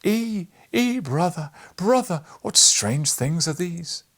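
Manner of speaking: high, loud cries of amazement; amazed brother crying out, slow on the last words; astonished exclaiming. A narrator's reading. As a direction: throw up the voice in wonder, loud and high. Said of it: "and exclaimed".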